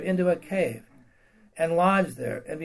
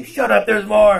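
loud, sharp retort; a loud, joking God shutting him up; mock rebuke; 4 semitones higher, 11 dB louder than usual. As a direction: snap back like an impatient God. God voiced cutting Elijah off.

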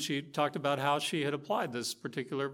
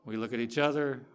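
ordinary talk

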